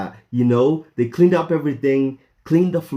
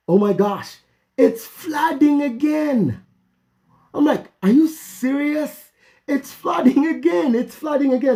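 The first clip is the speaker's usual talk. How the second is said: dismayed disbelief; 13 semitones higher than usual. Retold or imitated. Finding the kitchen flooded again, high dismayed wail. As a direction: groan it out, not again.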